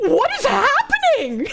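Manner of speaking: baffled. Retold or imitated. Retold confused reaction.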